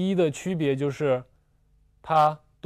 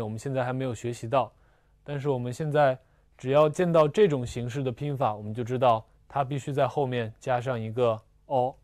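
normal talking voice

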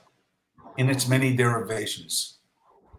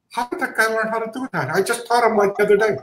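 higher, quick, wondering student voice; amazed student; 6 semitones higher, faster than usual. Retold or imitated. Teacher marvelling at a gifted student, quick and higher. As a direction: play the baffled teacher asking about a gifted pupil. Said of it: "You say".

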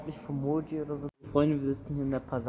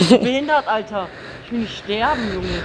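loud, higher frightened cries; scared yelling; 7 semitones higher, 12 dB louder than usual. Real, not acted. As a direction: yell in real fear. Scared passenger on motorbike.

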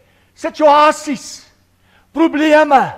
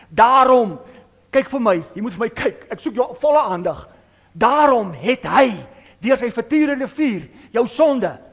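ordinary talk

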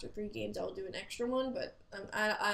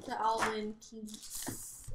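ordinary delivery

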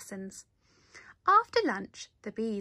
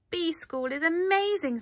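high, loud happy sigh of joy; delighted thought; 7 semitones higher, 5 dB louder than usual. A narrator's reading. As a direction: Norman thrilled by his new school. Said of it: "thought Norman".

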